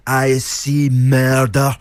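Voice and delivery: slow, grim German-tinged delivery; Herzog quote impression. Co-host quoting Herzog, German accent. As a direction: intone it like Herzog. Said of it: "is what he says".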